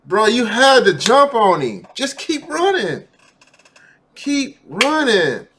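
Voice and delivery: loud, high, drawn-out cheering on; will him to keep running; urging on. Real, not acted. Urging suspect on screen.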